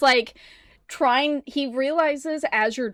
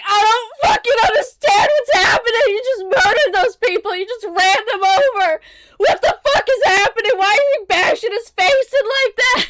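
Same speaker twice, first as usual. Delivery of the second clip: loud, higher, fast panicked crying shout; freaking out; 11 semitones higher, 8 dB louder than usual. Acted. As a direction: scream it in full panic mode. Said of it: "He's just freaking out".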